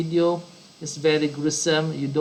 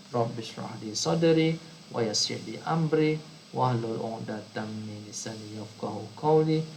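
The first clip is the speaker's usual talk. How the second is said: soft, even Arabic doa recital; prayer recitation; 3 semitones lower, 5 dB quieter, faster than usual. Real, not acted. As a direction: recite the opening prayer quietly and evenly. Recites doa before lesson.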